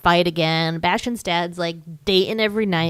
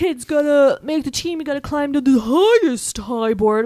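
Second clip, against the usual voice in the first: mock coach; 7 semitones higher, 4 dB louder than usual. Kids' coach barking orders.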